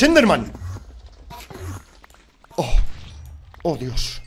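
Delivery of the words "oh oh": ordinary talk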